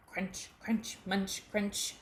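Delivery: plain reading voice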